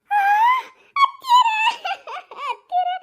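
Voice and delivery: high, loud cry of her name; loud shriek to her mother-in-law; alarmed cry. Acted.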